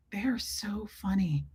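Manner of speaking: a breathless whisper. Real, not acted. Owner whispers fondly about her two cats. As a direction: whisper it to yourself.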